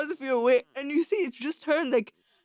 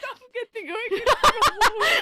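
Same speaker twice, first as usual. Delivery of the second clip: high, laughing through the words; 10 semitones higher, 4 dB louder than usual. Real, not acted. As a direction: giggle while saying it. Repeating the quote, laughing.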